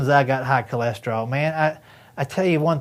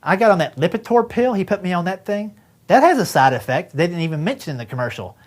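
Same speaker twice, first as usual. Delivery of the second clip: ordinary talk, nothing marked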